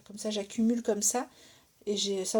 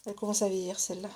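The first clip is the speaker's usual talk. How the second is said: fast, throwaway remark; quick aside; 3 dB quieter, faster than usual. Real, not acted. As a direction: toss it off quickly. Remark on her old pliers.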